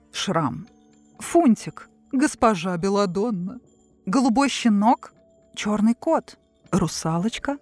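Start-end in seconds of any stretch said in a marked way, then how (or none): none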